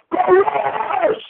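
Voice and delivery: high, drawn-out fan yell; rallying cheer. Real, not acted. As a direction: stretch it into a sign-off battle cry. Final rallying yell to sign off.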